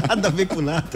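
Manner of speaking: laughing. Real, not acted. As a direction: laugh high while talking. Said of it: "Num bar?".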